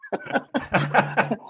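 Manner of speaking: high burst of laughter at his own mishap; a genuine high laugh; real laugh. Real, not acted.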